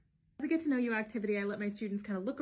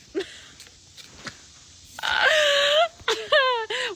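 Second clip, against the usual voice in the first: a high shrieking laugh, much louder than her talk; loud burst of laughter; 12 dB louder than usual. Real, not acted. Laughing loud after fans ask if she competes with her sister. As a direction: cackle out at the very idea of competing.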